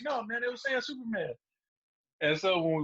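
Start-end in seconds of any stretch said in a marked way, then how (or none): 0.0-1.3 insistent brother. Retold or imitated. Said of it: "My brother's like".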